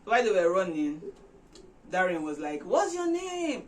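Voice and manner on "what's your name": high, slow called-out question; shouting her name question across the street; yelled question. Retold or imitated. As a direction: call after her as she runs off. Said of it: "She now yelled as she ran".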